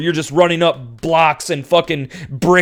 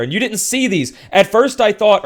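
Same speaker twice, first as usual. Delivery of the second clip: normal talking voice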